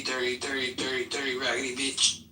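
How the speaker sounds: usual speaking voice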